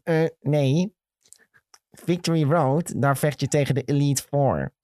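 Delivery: slow, pedantic mock correction; mock wise-guy voice; know-it-all commenter. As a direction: imitate a smart-aleck correcting someone, drawing out the name. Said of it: "Wijsneuzen zijn het gewoon".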